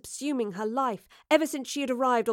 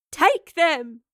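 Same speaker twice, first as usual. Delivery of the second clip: loud, raised-pitch desperate command; shout; 6 semitones higher, 7 dB louder than usual. A narrator's reading. Evie to Lorelei over the gateway's roar, shouting. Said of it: "she shouted above the roar of the gateway".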